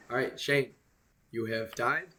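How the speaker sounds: usual speaking voice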